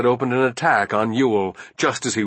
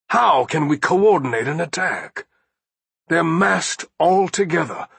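impassioned, mounting argument, pitched higher; protesting general; 6 semitones higher than usual. Acted. General pleads with his commander over a bad position, urgently. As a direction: press the case urgently, then settle into a firm appeal.

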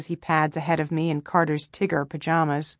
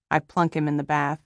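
ordinary delivery

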